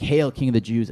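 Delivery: mocking hail. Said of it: "this is incredibly mocking".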